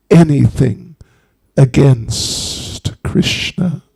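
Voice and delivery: slow, commanding snake with drawn-out esses; menacing snake voice. Acted. Snake ordering the old woman to repent, menacing. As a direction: pass judgment slowly, stretching vowels and sibilants. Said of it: "And the snake said".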